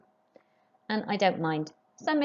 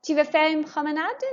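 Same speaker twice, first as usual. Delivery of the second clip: much higher, lifting on promenade; rising question tune; 9 semitones higher than usual. Shown as a demonstration. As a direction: model it high, ending up on promenade. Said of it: "raise your voice just before the question mark".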